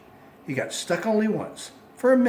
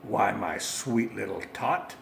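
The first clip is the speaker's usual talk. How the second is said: lower, slow sugary lie; lying sweetly; 5 semitones lower than usual. Acted. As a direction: coax like a fake Santa. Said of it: "the fake Santa Claus lied".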